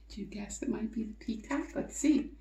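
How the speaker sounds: hushed fast whisper. Real, not acted. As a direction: lean in and whisper the guess. Teasing question to kids.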